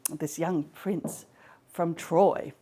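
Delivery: usual speaking voice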